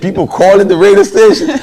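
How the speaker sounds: loud, high, thrilled exclamation; overjoyed, it's happening; excited. Retold or imitated. Telling his roommate the news, loud and thrilled.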